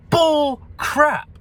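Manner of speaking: very high, loud cry of disgust; indignant shout. Real, not acted. Rejecting his own excuse, indignant high shout. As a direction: shout it down, each word punched.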